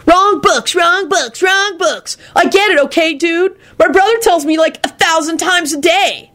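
a sulky dude yelling the same phrase; high exasperated slacker voice; high whiny stoner, irritated. Acted. Kenny repeating, irritated.

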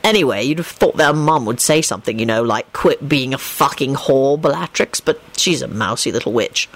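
low character voice, dry; wry low-voiced storyteller; Lupin recounting gossip. A narrator's reading. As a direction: tell it low and unhurried. Lupin continues his story.

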